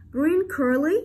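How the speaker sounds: high "Green curry?" climbing on "curry"; rising intonation. Shown as a demonstration. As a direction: ask it by lifting "curry". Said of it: "Rising intonation". (giọng cao, lên ở cuối "curry")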